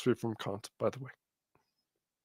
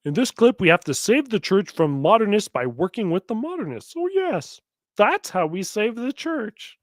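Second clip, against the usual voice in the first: sarcastic mock; 4 semitones higher than usual. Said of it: "Now watch what he goes".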